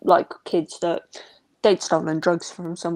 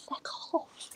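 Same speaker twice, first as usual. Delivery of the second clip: softer, unvoiced whispered fuck off; whispered swear; 14 dB quieter than usual. Nan quietly cursing the nosy neighbours, whispered. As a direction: hiss it under the breath.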